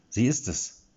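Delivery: insist, stubborn; defiant. A narrator's reading. Erik answering his doubting companion, defiant. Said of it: "gab Erik trotzig zurück".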